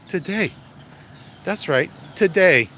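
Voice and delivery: "today" said high then loud and slow; emphatic today. Real, not acted. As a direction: punch out "today" loudly, slowly.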